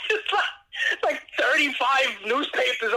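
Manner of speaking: high, breathy laugh through the words; can barely get it out for laughing; real giggling disbelief. Real, not acted. Laughing while telling dog story.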